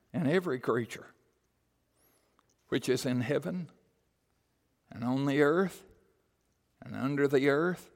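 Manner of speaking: measured reading with long pauses between phrases; solemn scripture reading. Real, not acted. Preacher reading the worship of all creation, solemn scripture reading. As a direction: read phrase by phrase, letting each stand alone.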